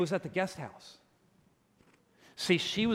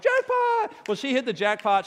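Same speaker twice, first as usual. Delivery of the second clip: much higher, louder excited cry of Jackpot; 8 semitones higher, 5 dB louder than usual. Retold or imitated. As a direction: shriek it like you just won. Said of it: "I'm like".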